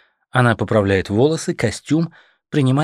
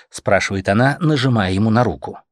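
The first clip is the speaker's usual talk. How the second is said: usual speaking voice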